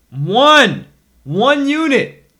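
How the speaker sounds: loud and high, one unit stretched slowly; slow, loud, rising insistence on one unit; emphatic shout. Real, not acted. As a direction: hammer home the single unit.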